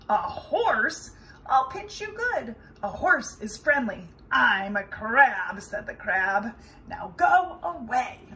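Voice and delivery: loud cranky crab voice; grumpy crab. Acted. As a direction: bark it out like a crabby crab. Grumpy crab threatens Roy.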